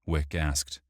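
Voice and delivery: usual speaking voice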